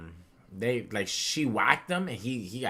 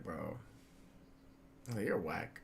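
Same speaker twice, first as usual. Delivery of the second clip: deflated whisper; 3 semitones lower, 12 dB quieter, much slower than usual. Retold or imitated.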